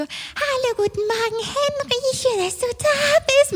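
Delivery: high, syrupy sweet teacher voice, slow at the end; psycho voice. Retold or imitated. Mocking the colleague greeting Henry in her sugary voice. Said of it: "mit ihrer Psychostimme".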